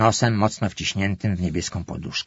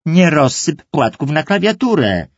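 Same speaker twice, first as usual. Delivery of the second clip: wysokie, głośniejsze ostrzeżenie matki; 7 semitones higher, 5 dB louder than usual. Acted. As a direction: upomnij córkę, zanim narobi bałaganu. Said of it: "ostrzegła matka". (matka ostrzega córkę przy komputerze)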